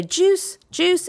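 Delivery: put-on juice, juice sung out high and clear for a child to copy; modeling juice high and slow. Shown as a demonstration. Therapist modeling juice to a toddler, high and clear.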